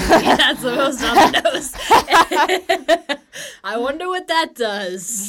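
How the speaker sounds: loud, high, laughing through the words; real laughter. Real, not acted. Joking with co-host about an obvious name, laughing hard. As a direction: crack up while talking.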